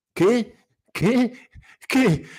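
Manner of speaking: high, frightened stammering, each word jumping up; startled stammer. A narrator's reading. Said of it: "balbettò il povero sorpreso". (balbettio spaventato e acuto)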